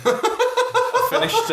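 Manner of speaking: slow, high words squeezed out through laughter; can barely talk for laughing. Real, not acted. Laughing mid-story.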